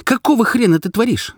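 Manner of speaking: high-pitched outraged cry; indignant screamed demand; angry yell. A narrator's reading. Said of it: "закричала я".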